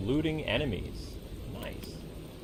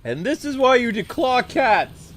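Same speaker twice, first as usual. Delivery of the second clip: shaken rant; 11 semitones higher, 8 dB louder than usual. Real, not acted.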